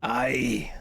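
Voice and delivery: a whispered Scottish "aye". Retold or imitated. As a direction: breathe a Scots "aye". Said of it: "the last thing I said in Scottish".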